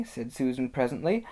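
usual speaking voice

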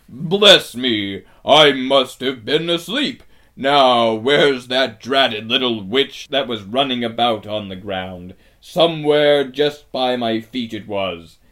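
big, loud giant voice; waking giant. A narrator's reading. Giant wakes and looks for the witch, booming bewilderment. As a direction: boom it out like a giant just woken.

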